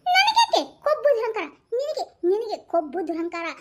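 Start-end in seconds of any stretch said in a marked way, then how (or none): 0.1-1.5 higher, louder indignant protest; louder, higher offended protest; indignant retort. Acted. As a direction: throw the insult back. Wife protests insult.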